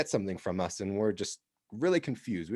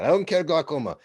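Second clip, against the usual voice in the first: a louder Italian chief in broken English; Italian accent; 3 semitones higher, 4 dB louder than usual. Retold or imitated. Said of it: "He said".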